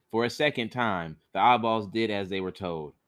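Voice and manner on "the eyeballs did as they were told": ordinary delivery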